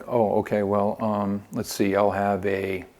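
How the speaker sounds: low, soft, hesitant customer; deflated and unsure, matching their low energy; mirroring a glum tone. Acted. Customer mirrors the salesperson's bad day, glum tone. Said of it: "you'll start talking in the same tone".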